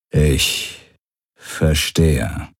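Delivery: low, halting reply; displeased commander. A narrator's reading. Commander hiding displeasure from his guests, low halting voice. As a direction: leave a heavy pause mid-phrase.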